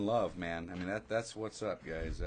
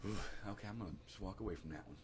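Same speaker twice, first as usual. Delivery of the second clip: soft, low, quick letting-it-go; calm walk-away; 4 semitones lower, 12 dB quieter, faster than usual. Retold or imitated. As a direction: shrug it off under your breath. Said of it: "he can go".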